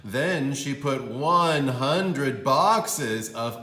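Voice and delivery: slow and loud on the huge number; read-aloud emphasis on the amount; big dramatic buildup. A narrator's reading. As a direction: stretch out one hundred for effect.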